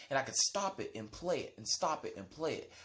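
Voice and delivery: normal talking voice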